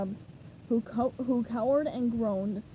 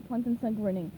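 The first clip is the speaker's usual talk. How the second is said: usual speaking voice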